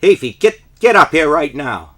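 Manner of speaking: loud, high summons; teacher yelling. Retold or imitated. Teacher yelling a kid to the front, loud. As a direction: bellow his name up to the front. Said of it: "says to me".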